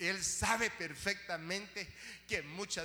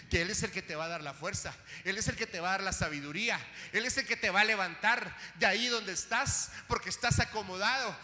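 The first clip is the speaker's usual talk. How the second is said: fast at first, then higher, louder shouting; preaching at full force; 5 semitones higher, 15 dB louder than usual. Acted. Preacher shaking off the mantle of mourning, shouting at full force. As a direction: rise into a prophetic cry.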